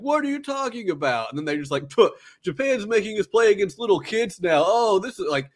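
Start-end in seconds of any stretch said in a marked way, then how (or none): 0.0-1.3 high-pitched incredulous imitation; mock scoffing. Voices the scoffing players' reply. 1.9-5.3 high, louder jeering imitation; pitched-up mock outrage; mocking scorn. Voices players insulting Kuroko.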